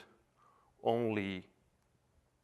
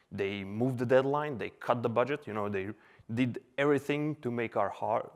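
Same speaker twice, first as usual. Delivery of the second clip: ordinary delivery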